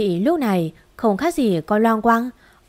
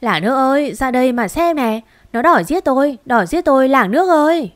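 louder, higher fake wail for the village; wailing cry for help; 4 semitones higher, 4 dB louder than usual. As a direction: cry out to the whole village, building. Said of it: "bài hãi cái miệng".

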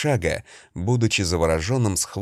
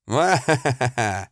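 slow, booming crazed laugh; mad laughter; 3 semitones higher, slower than usual. Acted. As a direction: laugh like a madman. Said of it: "Разразившись безумным смехом".

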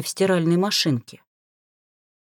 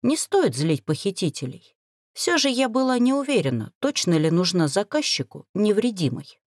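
usual speaking voice

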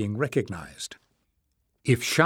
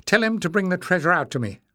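higher, fast and curt command; abrupt order; 7 semitones higher, 3 dB louder, faster than usual. Acted. Jasmine ordering the stranger, in a fast, high abrupt command. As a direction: snap out the order quickly. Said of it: "Jasmine ordered abruptly".

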